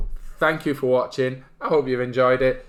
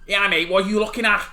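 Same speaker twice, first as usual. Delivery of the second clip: higher, confrontational Mancunian challenge; Mancunian accent; 8 semitones higher, 4 dB louder than usual. Shown as a demonstration. Showing Mancunian but drifting Scouse, confrontational Mancunian accent. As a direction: challenge someone in a Manchester accent. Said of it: "And then Mancunian".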